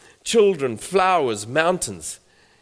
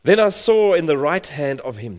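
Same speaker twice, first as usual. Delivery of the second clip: louder, proclaiming Bible reading; scripture reading; 6 dB louder than usual. Acted. Preacher reading Revelation five to the congregation, proclaiming. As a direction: declaim the verse to the church. Reads Revelation aloud with force.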